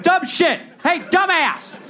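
high, loud mocking heckle; mock heckler. Cohost imitating a jeering audience, high and loud. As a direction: squeal the insult like a jeering crowd. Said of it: "And the state's like".

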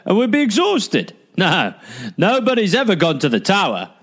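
put-on high, lazy English guard accent; mock English guard accent. Guard refusing to climb the tower stairs, a high hammed-up English accent. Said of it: "What was that accent?".

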